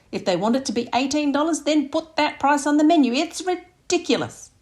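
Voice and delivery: high and loud, rising outrage; indignant rant. Acted. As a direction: rant at the unfairness of it all. Mum's tipping rant.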